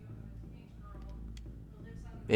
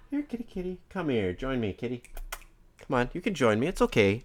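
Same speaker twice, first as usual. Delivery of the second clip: soft coaxing voice, much softer; coaxing the cat; 3 semitones higher, 7 dB quieter than usual. Real, not acted. Calling his cat onto his lap, soft and coaxing. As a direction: gently lure a shy cat close.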